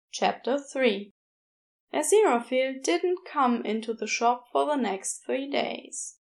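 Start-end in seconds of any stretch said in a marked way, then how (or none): none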